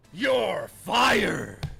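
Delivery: shouted with YOU'RE punched hard; shouting. Real, not acted. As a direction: yell it at the fired chatter.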